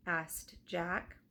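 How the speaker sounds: ordinary delivery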